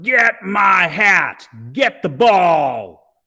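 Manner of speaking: loud, slow bellowed orders; roaring. A narrator's reading. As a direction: roar the orders, drawn out. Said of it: "He roared".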